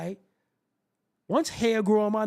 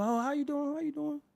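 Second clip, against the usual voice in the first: overly polite greeting; 7 semitones higher, 5 dB quieter than usual. Acted. Polite greeting to friend's girl.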